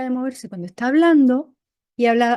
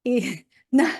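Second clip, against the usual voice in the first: Argentine accent; slower than usual. Shown as a demonstration. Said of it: "de vez en cuando se lo suelto".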